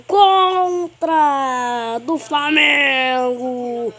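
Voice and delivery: high, slow, stretched goal scream; explosive goal commentary. Acted. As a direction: drag the goal call out, screaming.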